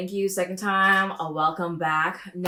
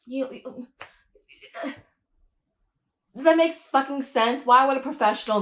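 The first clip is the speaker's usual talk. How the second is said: higher, exasperated disbelief; incredulous scolding; 10 semitones higher, 6 dB louder than usual. Real, not acted. Scolding Angela on screen, incredulous and high. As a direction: talk to her like she's lost her mind.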